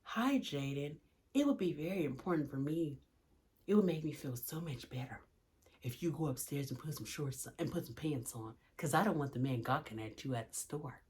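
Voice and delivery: sugary-sweet, very soft and low; hushed, exaggeratedly gentle pleading; said with a smile. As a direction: smile through a quiet, careful request. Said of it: "Let me say it again with a smile".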